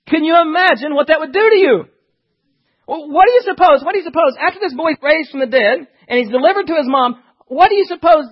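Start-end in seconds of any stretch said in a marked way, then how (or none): none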